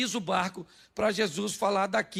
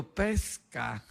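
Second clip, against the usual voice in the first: low, incredulous echo; voicing Pedro; 9 semitones lower, 3 dB quieter than usual. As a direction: drop the pitch in mock disbelief. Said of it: "Pedro falou".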